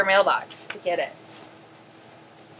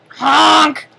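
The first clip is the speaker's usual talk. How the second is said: loud clown honk; 5 semitones higher, 7 dB louder than usual. Acted. Clown honks.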